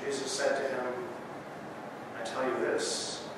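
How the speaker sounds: hushed Good Friday reading; scripture reading. Acted. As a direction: read the Passion quietly, letting each line land. Reads the Passion scripture.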